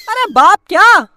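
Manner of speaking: ordinary delivery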